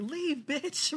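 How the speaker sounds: high, soft imitation of yelling; half-voiced high mock command. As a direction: mimic a yell without the volume. Mimics her friend yelling at woman.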